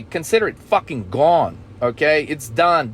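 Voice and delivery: ordinary talk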